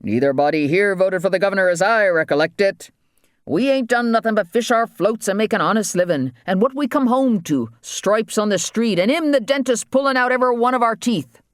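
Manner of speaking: high-pitched rural dialect protest; protesting islander. Acted. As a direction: protest high as a wronged waterman.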